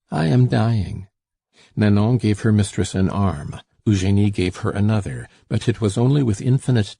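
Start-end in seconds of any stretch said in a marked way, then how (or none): none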